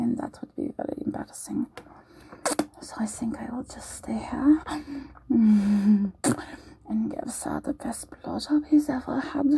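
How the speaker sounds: light put-on German accent drifting toward British, often whispered. Roleplay maid teasing her guest, in a wobbly put-on German accent. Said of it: "my accent varies between German and perhaps British".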